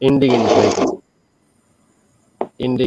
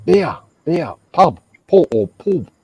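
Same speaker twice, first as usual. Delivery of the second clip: higher, louder impression of a Welsh uncle; mock Welshman proposing a drink in bursts; imitated Welsh uncle; 6 semitones higher, 4 dB louder than usual. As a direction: bark out single words like a Welsh uncle. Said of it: "You try to understand a fucking Welshman".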